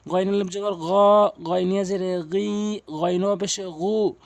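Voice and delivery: voiced gh from the top of the throat on "গ", "গি", "গু"; ghayn spelling drill. Shown as a demonstration. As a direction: spell out, ending on throaty long "গ", "গি", "গু". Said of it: "হলকের শেষ থেকে উচ্চারণ করবেন".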